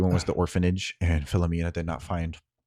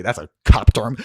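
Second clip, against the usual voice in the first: much louder aside; loud joking aside; 2 semitones higher, 12 dB louder than usual. Acted. Player remarking on the police term, loudly. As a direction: call it out, amused.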